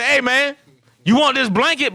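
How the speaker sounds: a mock-tough "want this blanket?"; aggressive offer. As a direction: toss the offer at him like a dare. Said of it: "you gotta be aggressive about it".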